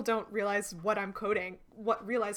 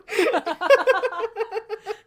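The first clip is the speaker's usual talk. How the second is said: high, loud burst of real laughter; helpless laughing fit; 10 semitones higher, 10 dB louder than usual. Real, not acted. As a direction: crack up completely. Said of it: "I'm laughing because it's stupid".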